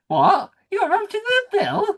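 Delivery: very high, hammed-up disbelief; mock astonishment. Host playing the family hearing her story, mock astonishment. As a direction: squeal it like an amazed relative. Imagines listener's amazed reaction.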